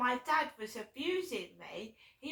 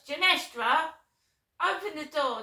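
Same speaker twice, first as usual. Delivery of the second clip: called out louder and higher through the flat; calling out; 5 semitones higher, 8 dB louder than usual. Retold or imitated. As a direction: shout for her daughter from another room. Mum calling from the toilet.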